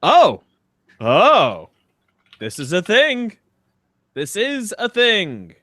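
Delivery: normal talking voice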